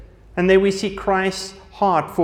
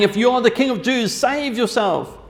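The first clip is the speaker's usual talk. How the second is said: mocking soldiers; 5 semitones higher than usual. A narrator's reading. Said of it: "The soldiers also mocked him".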